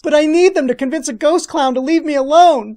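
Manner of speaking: high, loud mock child's plea; hammed-up whiny pleading; pleading kid. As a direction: beg loudly like a desperate kid. Acted kid pleading with clerk.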